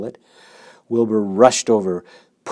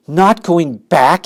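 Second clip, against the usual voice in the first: high, loud, slow disbelieving cry; shocked cry; 7 semitones higher, 10 dB louder, slower than usual. A narrator's reading. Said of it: "he cried".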